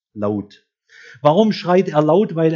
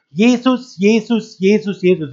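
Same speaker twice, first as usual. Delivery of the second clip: high, loud calls of the name, retold; imitated crowd cry; 7 semitones higher, 7 dB louder than usual. Preacher retelling five thousand people in a storm-hit tent, imitated cry. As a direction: re-enact a crowd crying out, voice raised. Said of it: "nur noch ein einziger Schrei".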